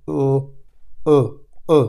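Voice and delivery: ও repeated as a rounded ö, tongue pressed forward; repeated ö demo; German ö sound. Shown as a demonstration. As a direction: drill ও as the umlaut ö sound. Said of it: "এখান থেকে উচ্চারণটা একটু জিব্রের এখানে একটু আসে".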